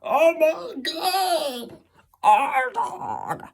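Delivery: very high, stretched-out goofy cries of surprise; dopey Freddy voice. Retold or imitated. Imitating Freddy spotting the narrator, high goofy squeal. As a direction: squeal it like a wide-eyed dimwit. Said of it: "I don't know how he talks".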